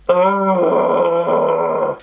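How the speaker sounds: long, drawn-out groan of "oh"; hammed-up weary groan, held on one note; exasperated groan.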